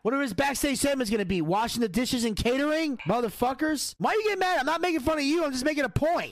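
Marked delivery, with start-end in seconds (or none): 4.0-6.3 high-pitched, pleading self-defense; defensive protest. Real, not acted. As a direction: protest innocence in a raised pitch. Defensive squabble with co-host.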